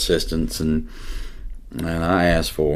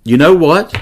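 eager news; 11 semitones higher, 11 dB louder than usual. Retold or imitated. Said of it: "She's like".

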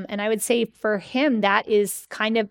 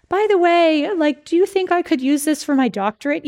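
higher, louder offhand question; timid by-the-way ask; 7 semitones higher, 5 dB louder than usual. Retold or imitated. Schwartz asking Friedman, high tentative voice. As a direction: slip the big request in casually. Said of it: "she's writing this letter".

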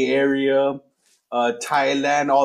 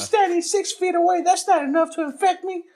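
put-on much higher, smug little kid; high child voice. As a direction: argue like a know-it-all child. Kid arguing on playground.

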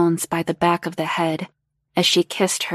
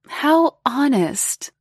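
slow, higher-pitched teasing purr; 7 semitones higher, slower than usual. A narrator's reading. Older woman approving her guest's honesty, purring. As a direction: draw it out with a purr. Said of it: "Missus Jones purred".

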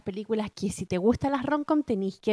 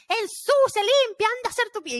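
high, loud mock scolding; 12 semitones higher, 4 dB louder than usual. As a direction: bark it like a chancla-wielding mom. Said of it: "y gritando así como muy".

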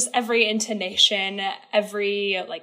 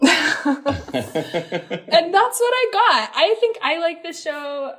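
laughing; 5 semitones higher, much slower than usual. Real, not acted. Laughing slowly winds up.

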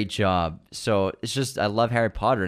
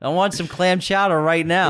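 put-on Boston accent; 8 semitones higher, 4 dB louder than usual. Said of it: "Boston Aladdin".

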